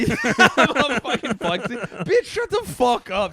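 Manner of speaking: hammed-up indignant retort; mock mad. Comic boasts back at women calling him nervous, mock mad. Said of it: "I get all mad".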